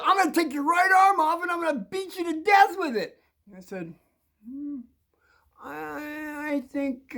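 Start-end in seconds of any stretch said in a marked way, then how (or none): 0.0-3.2 high, shouted bully tirade; escalating threat. Retold or imitated. 4.5-7.2 high, soft, drawn-out hesitation; hesitant reply. Retold or imitated. Kid hesitates after the threat.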